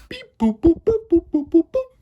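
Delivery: high, loud mimicked phone beeps; beep boop noises. Dialing out with high, loud beep boop noises. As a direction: chirp the beeps like a keypad.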